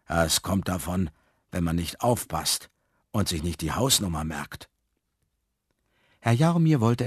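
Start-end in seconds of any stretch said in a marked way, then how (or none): 0.1-4.7 low, regretful man's voice; sad fat man. A narrator's reading. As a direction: admit it sadly, pitched low. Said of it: "nickte traurig mit dem Kopf". (traurig, mit tiefer Stimme)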